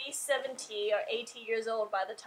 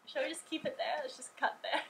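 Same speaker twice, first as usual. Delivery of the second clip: ordinary talk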